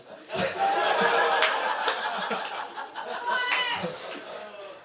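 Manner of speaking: long airy laugh high up; real laugh; high breathless laugh. Real, not acted. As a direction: crack up at the punchline. Laughing after stick-out-hand joke.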